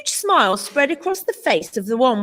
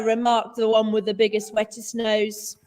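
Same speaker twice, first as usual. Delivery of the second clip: plain reading voice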